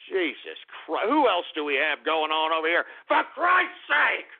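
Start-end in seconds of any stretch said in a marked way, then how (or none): 3.1-4.3 drawn-out, high-pitched exasperation; exasperated outburst. Real, not acted. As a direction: cry out, fed up and slow. Host exasperated by the show.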